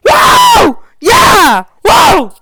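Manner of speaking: very loud, high whooping cheers; excited and happy. Acted. Freddy hears she wants decapitation, excited whooping. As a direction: shriek with wild acted delight. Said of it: "excited and happy".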